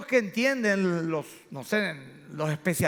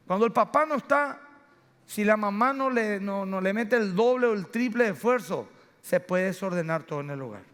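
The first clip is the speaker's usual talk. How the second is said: ordinary talk, nothing marked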